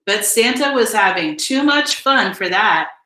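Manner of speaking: usual speaking voice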